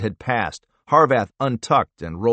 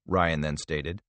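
ordinary delivery, nothing marked

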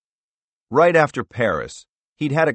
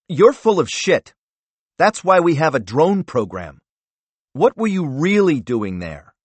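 higher and louder, contemptuous; angry accusation; 7 semitones higher, 6 dB louder than usual. Acted. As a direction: throw the lie back at him. Senator calls out lie.

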